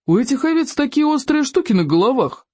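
put-on high-pitched boy reporting an observation; Бестолков. A narrator's reading. Pupil tells teacher about the sheep, high naive voice. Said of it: "сообщил Бестолков".

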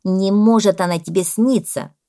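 loud, emphatic parrot objection; indignant parrot. A narrator's reading. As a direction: object loudly as the outraged parrot. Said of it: "Зато возмутился попугай". (возмущённый попугай, громко)

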